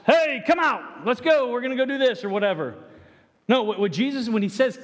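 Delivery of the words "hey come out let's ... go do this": casual yell. Retold or imitated. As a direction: yell into the house to a friend. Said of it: "yell into the house".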